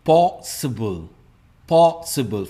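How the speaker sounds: Possible stressed on PO; first-syllable stress. Shown as a demonstration. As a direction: open possible strong on PO. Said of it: "first part में इसका stress". (Possible में पहले हिस्से पर ज़ोर)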